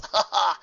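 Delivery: short amused laugh; light laugh. A narrator's reading. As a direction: laugh brightly. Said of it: "Cloud Cover laughed".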